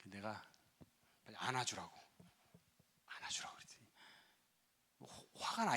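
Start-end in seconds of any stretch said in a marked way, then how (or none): none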